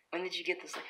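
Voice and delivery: hushed stunned whisper. Real, not acted. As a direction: ask it in a bare whisper. Whispering at cat's catch.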